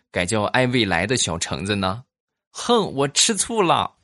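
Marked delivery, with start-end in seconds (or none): 2.5-3.9 high, slow playful huff; put-on sulky whine; mock jealousy. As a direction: pout it like a jealous sweetheart.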